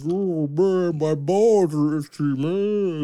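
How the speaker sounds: a lightly voiced dopey stoner drawl; imitating his high voice. Friend mocking how he talks when high, lightly dazed. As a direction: mimic a friend high on drugs, slow and dazed. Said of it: "then you talk like this".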